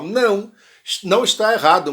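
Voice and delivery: normal talking voice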